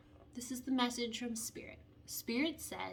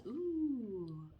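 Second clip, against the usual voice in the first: ordinary delivery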